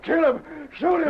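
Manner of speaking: high frantic yelled orders; shouting. Acted. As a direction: yell it out in a panic. Boss orders henchmen in fight.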